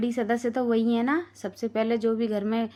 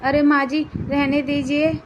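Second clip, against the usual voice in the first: louder, urgent pleading; loud plea; 4 semitones higher, 6 dB louder than usual. Acted. Daughter-in-law begging mother-in-law to stop, loud plea. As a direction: beg her loudly to stop.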